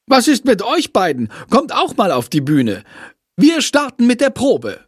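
scolding. A narrator's reading. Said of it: "schimpft Frau Schmidt".

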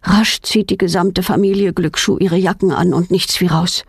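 plain reading voice

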